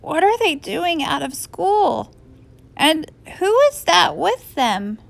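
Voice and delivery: a touch of sore-throated astonishment; puzzled teacher, mildly scratchy. A narrator's reading. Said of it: "in a scratchy voice".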